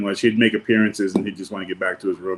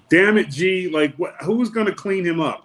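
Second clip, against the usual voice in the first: high-pitched, mock-exasperated protest; exasperated retold complaint; 9 semitones higher than usual. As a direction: complain in a high, put-upon voice. Exasperated boss quoted in story.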